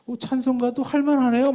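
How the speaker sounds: high, loud pleasant surprise; impressed student. Retold or imitated. A guitar student admits hymns are fine, impressed high voice. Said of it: "그럼 뭐라 그러냐면".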